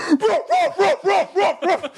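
high yappy mock barks; playful imitated woofs; dog barking imitation. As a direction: bark like a dog in the road. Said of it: "we hear".

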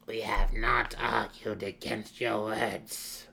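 put-on very slow, measured old matriarch; withered matron mother. A narrator's reading. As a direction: pronounce it slowly, with weighty authority. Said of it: "Matron Benry interrupted".

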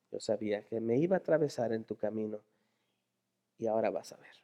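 low, soft, intimate voice; quiet assurance. Acted. Jesús speaking privately to the blind man, quiet assurance. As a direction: promise it gently under your breath. Jesus' words voiced tenderly.

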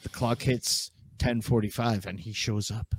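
usual speaking voice

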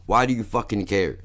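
ordinary delivery

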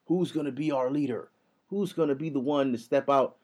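mock dramatic speech. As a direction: parody a grave call for a leader, low and hushed. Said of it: "The Rock is making this dramatic ass speech".

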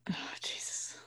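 breathy whispered "oh Jesus"; exasperated whisper under breath; whispered exasperation. Real, not acted. Frustrated after flubbing.

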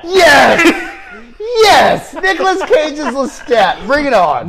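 high, loud, gleeful shouting; excited cheer. Real, not acted. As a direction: cheer it like a fan whose dream casting just came true. Cheering Nicolas Cage as Lestat.

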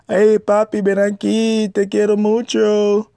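high, coaxing mock Spanish come-on; flirty Dominican woman. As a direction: imitate a woman sweet-talking an American man in Spanish. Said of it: "A lot of Dominican".

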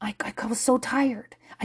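whispered confession of an idiot. Real, not acted. Parent admitting to the audience she messed up, whispering. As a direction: hush it like a guilty admission. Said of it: "I am an".

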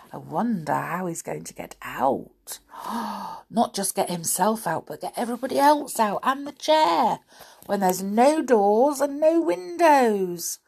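ordinary delivery

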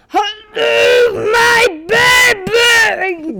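very high, loud drawn-out holler; demonstrated silly voice. Shown as a demonstration. Kid shows which voice to do, high mock bellow. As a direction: belt it out huge and stretched. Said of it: "talk like this".

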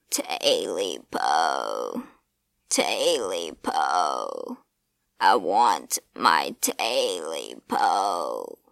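a deep inhuman leer of a voice; creature voice. A narrator's reading. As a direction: rumble it deep over the victim. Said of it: "the creature said".

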